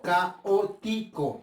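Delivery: "caótico" slowly broken into ca - o - ti - co; four slow, detached syllables in "caótico". Shown as a demonstration. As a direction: pause after each syllable of "caótico". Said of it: "Ya las separé en sílabas".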